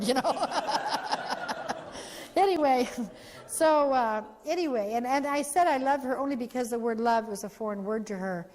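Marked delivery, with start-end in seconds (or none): 0.3-2.3 breathy laugh. Real, not acted. Speaker laughs after story beat.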